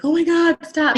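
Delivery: mock overwhelmed shout; overwhelmed cry. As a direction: beg it to stop. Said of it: "being like".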